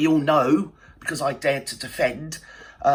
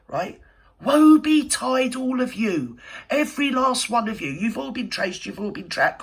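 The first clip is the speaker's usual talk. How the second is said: heated warning; 7 semitones higher than usual. Real, not acted. A vlogger warning the trolls harassing Trevor, heated threat. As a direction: rise up and warn them off, getting fiercer.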